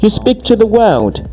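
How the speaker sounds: very high-pitched, incredulous telling-off; animated scolding. Acted. Teacher tells off an imagined reporter, exasperated and high-pitched. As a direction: argue back in a high, exasperated voice.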